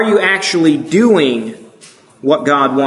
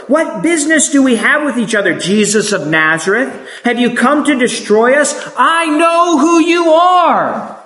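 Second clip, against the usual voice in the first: demon crying out at Jesus, voice pitched way up; 7 semitones higher than usual. Acted. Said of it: "who's crying out and is saying".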